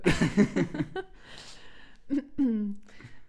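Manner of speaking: long high laugh; real drawn-out laughing fit; laughter. Real, not acted. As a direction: giggle on until it dies away. Laughs at own muddle.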